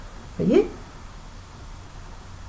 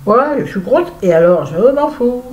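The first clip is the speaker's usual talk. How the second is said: defiant; 6 semitones higher, 6 dB louder than usual. Real, not acted. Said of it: "defiant declaration".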